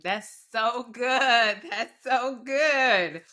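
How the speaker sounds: laughing, slow and delighted. Real, not acted. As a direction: savor it through a laugh. Laughs after host's point.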